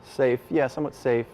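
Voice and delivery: ordinary talk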